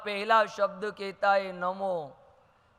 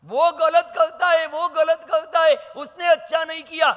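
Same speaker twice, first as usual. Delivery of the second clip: higher, louder whining complaint; complaining grumbler; 7 semitones higher, 9 dB louder than usual. Retold or imitated. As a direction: whine like someone always blaming others. Mimicking a complaining person. (ऊँची, तेज़ आवाज़ में शिकायत)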